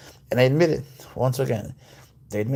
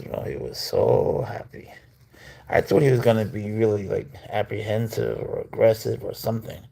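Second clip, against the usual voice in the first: ordinary talk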